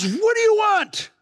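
impatient dad's call. Retold or imitated. Busy coach dad turns to son.

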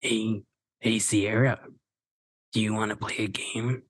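low, quiet menacing phone voice; creepy killer calling on the phone; Ghostface voice. Acted. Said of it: "Should I try doing the Ghostface voice?".

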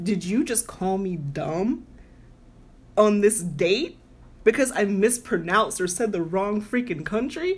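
slowed, deliberate, each word spaced; measured disbelief, slow; slow indignant question. Retold or imitated. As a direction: confront him slowly and pointedly. Confronting date about insult.

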